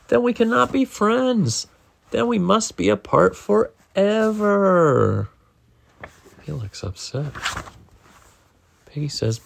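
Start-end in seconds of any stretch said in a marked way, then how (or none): 0.1-5.3 higher, slow, hammed-up sorrow; mock tragic. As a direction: play the grand farewell. Gerald's tragic farewell.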